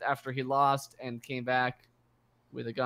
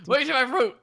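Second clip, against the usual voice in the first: high whiny greedy player; 12 semitones higher, 8 dB louder than usual. Pretend. Acting out a looting friend.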